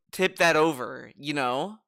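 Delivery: usual speaking voice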